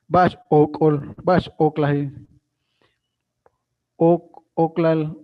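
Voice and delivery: Bash Okol said slowly and loudly; Maya word demo. Shown as a demonstration. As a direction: spell out Bash Okol for students. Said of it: "y significa, ¿por qué razón?".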